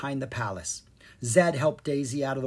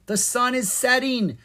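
raised, high-pitched cry of alarm; 10 semitones higher, 4 dB louder than usual. A narrator's reading. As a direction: cry out as time runs out. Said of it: "Zed cried".

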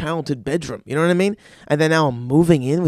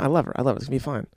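ordinary talk

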